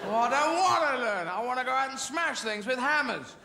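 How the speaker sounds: put-on high, protesting kid voice; whining child. Child refusing violin lessons, whining. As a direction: wail it like a stubborn child.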